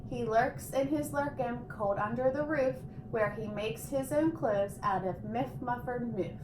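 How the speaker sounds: plain reading voice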